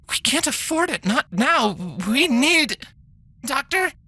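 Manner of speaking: tense doctor, words tightening; strained doctor. Acted.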